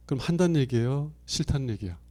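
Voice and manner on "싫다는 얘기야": ordinary delivery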